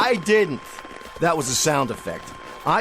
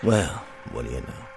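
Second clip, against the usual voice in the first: tough TV marshal; 9 semitones lower, 4 dB quieter than usual. Acted. Marshal to the saloon boys, low and cocky. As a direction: play the cool lawman facing down the saloon.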